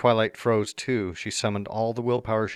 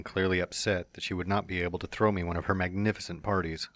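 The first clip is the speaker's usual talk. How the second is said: usual speaking voice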